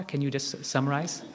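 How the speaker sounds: usual speaking voice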